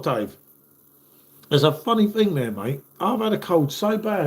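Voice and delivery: usual speaking voice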